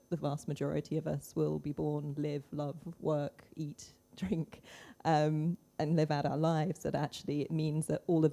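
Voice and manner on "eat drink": ordinary talk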